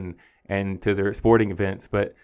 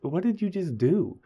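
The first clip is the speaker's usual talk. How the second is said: higher-pitched retold incredulous question; hushed "what?", then higher-pitched disbelief; scowling disbelief; 8 semitones higher, slower than usual. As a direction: ask it as if taken aback, mildly scowling. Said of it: "I scowled more than I wanted".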